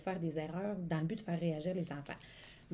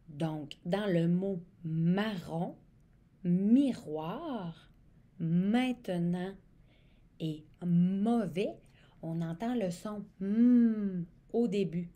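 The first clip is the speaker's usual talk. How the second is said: slow, louder, leaning on the M of "marron", "miroir", "maintenant", "mauvais"; stress on the M; 6 dB louder, much slower than usual. Shown as a demonstration. Teacher to children, stressing the M at the start of each word. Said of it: "Le son M, c'est la lettre M".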